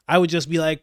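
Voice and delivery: normal talking voice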